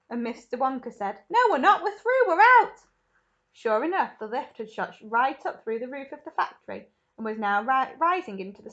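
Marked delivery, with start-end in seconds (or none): 1.3-2.8 high, loud, jubilant exclamations; triumphant shout. A narrator's reading. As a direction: cry out in victory. Wonka shouts back in triumph.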